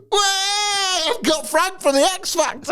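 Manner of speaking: very high, thrilled DJ shout; excited radio host. Retold or imitated. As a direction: announce it like a giddy DJ. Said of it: "cut to".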